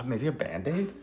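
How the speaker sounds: normal talking voice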